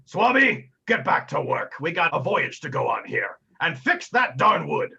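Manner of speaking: gruff order to a deckhand, opening high and loud; Captain Tumult. Acted. Captain scolding his deckhand to get working, commanding pirate bellow. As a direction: call the sailor to heel, slowing on the last order. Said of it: "Captain Tumult says".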